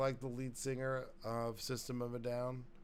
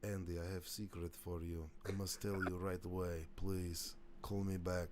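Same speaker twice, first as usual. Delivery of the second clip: lightly put-on Russian accent; 4 semitones lower than usual. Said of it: "That's when I poached the accent".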